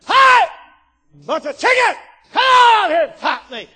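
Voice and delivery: high, loud shouted dare; Goliath bellowing insults; giant's taunting challenge. Retold or imitated. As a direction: holler a challenge across the battlefield. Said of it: "he cussed, he said".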